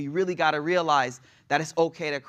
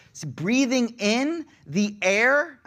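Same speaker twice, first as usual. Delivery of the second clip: slow savoring; 7 semitones higher, 3 dB louder, much slower than usual. Acted. Savoring quote in a sermon.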